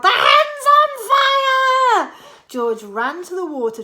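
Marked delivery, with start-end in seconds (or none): 0.0-2.1 high, loud, slow yell; high loud old woman yelling; Granny yelling. A narrator's reading. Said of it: "Granny yelled".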